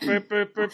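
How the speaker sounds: quick burping horn noises; imitated horn. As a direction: mouth horn burps, three short honks. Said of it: "he blew it twice".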